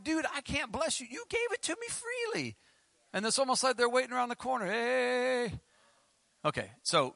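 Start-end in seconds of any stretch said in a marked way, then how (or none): 0.0-2.6 high-pitched, exasperated comic protest; mock protest. Retelling his reply to an expectant giver, high mock protest. 4.7-5.6 high, drawn-out "hey"; mock-friendly call, pitched way up; lurking favour-seeker. Someone waiting around the corner for payback, high mock-friendly greeting.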